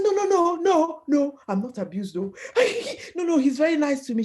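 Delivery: high, loud laughing no no no; nervous laughing protest; laughing denial. Retold or imitated. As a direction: brush it off with a laugh. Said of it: "I said".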